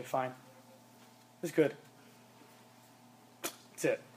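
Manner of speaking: low, soft, mumbled reply; teen shrugging off parent's question; bored brush-off. Acted.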